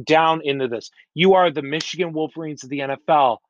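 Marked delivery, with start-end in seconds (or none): 1.1-3.4 rapid repetition. Shown as a demonstration. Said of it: "I'm gonna say this five times".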